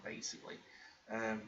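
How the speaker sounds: normal talking voice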